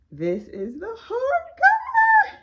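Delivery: high, loud, slow, near tears; on the verge of happy tears; choked-up joy over her first hardcover; emotional excitement. Real, not acted. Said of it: "I'm definitely emotional".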